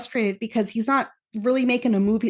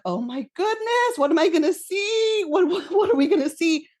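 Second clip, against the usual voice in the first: retold giddy anticipation; 7 semitones higher than usual. Fan reliving the scene as they enter the room, giddy and high. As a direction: relive the suspense in a high, eager voice.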